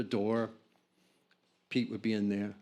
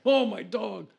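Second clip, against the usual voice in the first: high, loud fake wail; mock despair; 10 semitones higher, 5 dB louder than usual. Jack improvising grief in front of the band, hammed-up and high. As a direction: grieve a dog he never had. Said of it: "pretty good improv".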